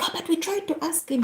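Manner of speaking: high-pitched imitated protest; lightly mocked, lifted voice; protesting colleagues. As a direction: put on a colleague's puzzled objection.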